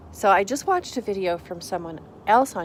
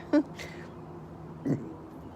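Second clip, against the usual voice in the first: breathy, whispered giggle; stifled laugh; 8 semitones higher, 4 dB quieter than usual. Real, not acted. Catching herself laughing during outro, whispered giggle. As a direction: laugh under your breath.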